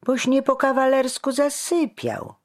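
high, loud aggrieved reproach; complaining. A narrator's reading. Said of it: "skarżył się Jur".

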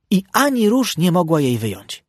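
plain reading voice

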